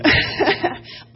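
short high breathy laugh at herself; nervous laugh. Real, not acted. To the church, a nervous laugh before her story. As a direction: laugh off the nerves.

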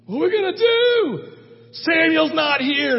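high, loud panicked cry; panicking soldiers. Retold or imitated. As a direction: yelp it out in panic. Army panicking.

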